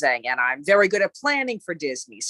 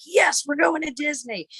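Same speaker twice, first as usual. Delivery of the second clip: much higher, thrilled cheer; excited; 10 semitones higher than usual. Retold or imitated. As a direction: squeal it like a kid off to Disney. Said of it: "that mood of".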